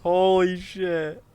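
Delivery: loud, startled outburst at the sight; shocked exclamation. Real, not acted. Gamer spotting Maik the NPC, loud shocked exclamation. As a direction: blurt it out in real shock.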